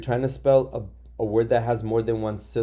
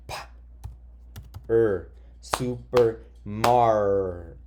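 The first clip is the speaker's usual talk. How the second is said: sounding out syllables; much slower than usual. Shown as a demonstration. Teacher counts sounds in a long word, slowly. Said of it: "count the sounds in per".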